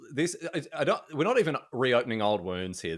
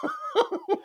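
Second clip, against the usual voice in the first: high burst of laughter. Real, not acted. Laughing at the groupie joke, high burst of laughter. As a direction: caught off guard, cracking up.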